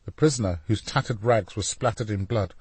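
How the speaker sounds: usual speaking voice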